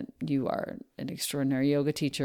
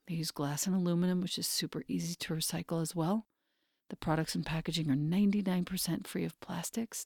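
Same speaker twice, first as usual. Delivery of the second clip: soft, intimate sponsor read; 6 dB quieter than usual. Acted. Host reading a skincare ad to her listeners, soft and intimate. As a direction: coax listeners gently toward the product.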